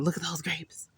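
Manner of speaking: whisper. Real, not acted. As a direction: breathe it under your voice. Whispered remark on the grapes.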